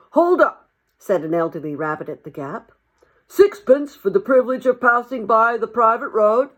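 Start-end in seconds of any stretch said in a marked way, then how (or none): 0.1-0.7 high, loud halt; elderly rabbit's call. Acted. Elderly rabbit halting the mole, high loud call. 3.3-6.5 put-on louder, higher old rabbit voice; an officious old toll-keeper; elderly rabbit. Elderly rabbit demanding a toll from the mole, loud and high.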